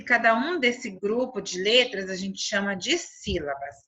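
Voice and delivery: ordinary talk, nothing marked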